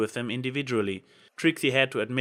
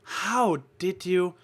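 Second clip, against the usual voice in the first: stunned; 7 semitones higher, much slower than usual. Acted. Twilight stunned.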